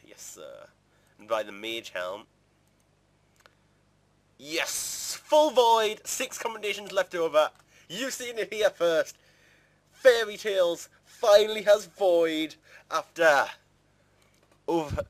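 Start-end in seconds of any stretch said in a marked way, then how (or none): 0.1-0.7 breathy whispered yes sir; whispered echo. Pretend. Gamer buying a helm, whispering to himself. Repeats the purchase reply softly. 4.4-7.5 loud, high burst of joy; real excitement. Real, not acted. Gamer finishing his armour set, thrilled and shouting. 7.9-15.0 slow, loud, higher announcer voice; mock announcement. Gamer announcing his Void to viewers, hammed-up announcer voice.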